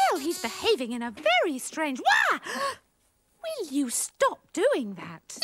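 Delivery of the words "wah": high, loud cry of fright; sudden shriek of surprise; startled yelp. Acted. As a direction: yelp as if someone popped up behind you. Jumps at dog appearing suddenly.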